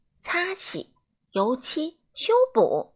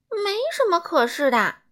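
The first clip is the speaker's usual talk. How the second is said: higher and louder, no arguments; firm brush-off; 7 semitones higher, 5 dB louder than usual. A narrator's reading. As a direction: cut him off briskly. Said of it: "整洁先生说着". (高声、干脆地打断)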